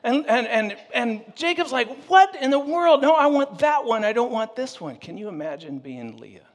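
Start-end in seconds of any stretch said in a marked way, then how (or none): 2.1-4.9 indignant protest. Retold or imitated. Jacob rejecting Leah to Laban.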